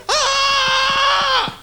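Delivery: sudden high shout out of nowhere; sudden yell. Retold or imitated. Blurting a yell in a silent library, sudden high shout. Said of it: "You stand in the middle of a library and go".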